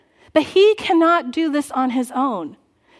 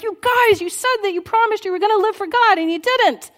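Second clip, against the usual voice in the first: higher, louder exasperated telling-off; angry scolding; 7 semitones higher, 3 dB louder than usual. Retold or imitated. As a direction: scold them for breaking their promise. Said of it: "And he gets so angry".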